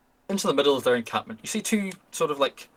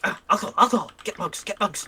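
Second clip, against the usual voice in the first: higher, gleeful goblin with a laugh; excited goblin; 6 semitones higher than usual. Acted. Goblin spurs his mates on for mugs, gleeful and higher. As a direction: rub your hands and cackle over the alcohol. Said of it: "rub his hands together and sort of jive up his mates".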